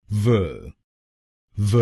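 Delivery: "व" as a short "vuh"; syllable demonstration. Shown as a demonstration. Teacher models the third syllable VA, carefully pronounced. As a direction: say "व" alone, a brief "vuh". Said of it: "तीसरे शब्दांश को यानी वीए हम बोलेंगे".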